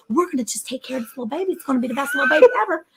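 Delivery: put-on high, fast gushing baby talk. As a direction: squeal it out like a doting parent. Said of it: "They're like".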